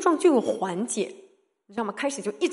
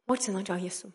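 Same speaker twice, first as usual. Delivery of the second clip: ordinary talk, nothing marked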